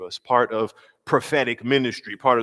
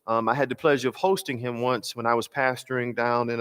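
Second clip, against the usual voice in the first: normal talking voice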